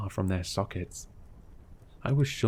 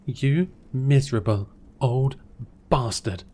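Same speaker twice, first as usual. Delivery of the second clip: loud, higher furious curse; cursing; 4 semitones higher, 6 dB louder than usual. Acted. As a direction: hurl the insult at the old man. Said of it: "I cursed".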